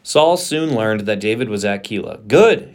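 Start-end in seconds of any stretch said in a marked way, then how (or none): 2.3-2.7 a sudden, higher, louder cry of triumph; excited exclamation. A narrator's reading. Saul gloats over David's capture, exclaiming loudly. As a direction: burst out with gleeful triumph. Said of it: "he exclaimed".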